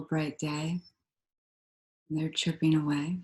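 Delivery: calm, unhurried meditation voice with long pauses; guided meditation. Real, not acted. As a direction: guide them gently into stillness, leaving space between phrases.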